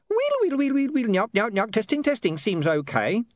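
very high squeaky chatter; tinny imp running a sound check; high imp voice warming up; imp testing itself. A narrator's reading. Imp plays recording.

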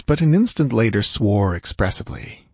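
plain reading voice

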